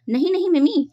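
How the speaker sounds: high, sudden startled no; alarmed cry. Acted. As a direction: blurt it out in alarm to stop her. Koko suddenly stops Mimi.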